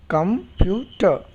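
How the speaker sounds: "Computer" slowly split com, pu, ter; syllable by syllable. Shown as a demonstration. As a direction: say "Computer" in three separate beats. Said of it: "Observe my pronunciation".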